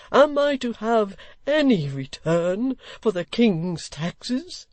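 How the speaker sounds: high, slow, aggrieved questions; desperate old gentleman. Acted. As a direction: hammer each question.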